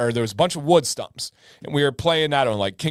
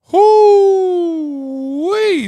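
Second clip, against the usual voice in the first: high, louder whoo-wee; loud whoop; 10 dB louder than usual. Real, not acted. Telling the chat about the stings, loud high whoop. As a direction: whoop at the memory.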